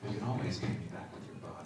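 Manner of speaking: quick, hushed teasing character line; Kyoto's line. Pretend. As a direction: toss it off fast under the breath. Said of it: "can you do Kyoto's line".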